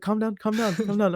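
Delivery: high, loud, repeated plea; urgent soothing, retold. Friend trying to settle a panicking stoner, urgent retold plea. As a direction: act out a flustered friend talking someone down.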